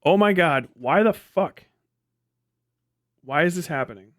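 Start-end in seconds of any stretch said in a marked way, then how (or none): none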